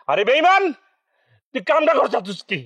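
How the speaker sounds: high-pitched, affronted scolding; indignant outrage. Acted. Boss outraged at being called brother.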